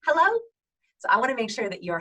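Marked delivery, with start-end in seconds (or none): 0.0-0.4 very high, loud, rising hello; nervous, tight-jawed high greeting; tense low-power hello. Shown as a demonstration. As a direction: hold the breath and pitch it way up. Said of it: "Low power is when I hold up my breath".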